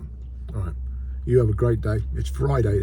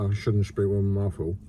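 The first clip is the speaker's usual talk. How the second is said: ordinary delivery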